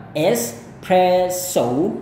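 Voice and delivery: very slow American espresso ending in ou. Shown as a demonstration. Teacher slowly models the American espresso. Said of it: "gaya Amerika".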